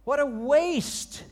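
high, loud retold protest; indignant disciples. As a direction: voice the disciples' outrage over the wasted nard. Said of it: "and he goes".

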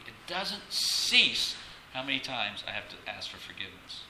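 usual speaking voice

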